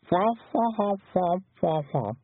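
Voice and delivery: high, wordless bird-headed chatter; peacock babble. A narrator's reading. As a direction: honk nonsense syllables like muffled speech. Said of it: "It sounded almost like a voice".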